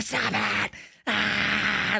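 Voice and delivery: high, strained mock scream; imitated scream. Podcaster imitates the official going crazy at a backstage brawl, screaming. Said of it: "screaming at the top of his fucking lungs".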